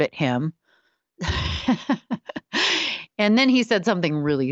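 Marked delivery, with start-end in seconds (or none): 1.2-3.2 a short high real laugh; high soft laugh. Real, not acted. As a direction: laugh at the memory. Glaring at husband, laughing.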